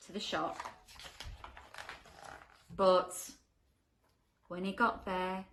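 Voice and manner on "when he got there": ordinary delivery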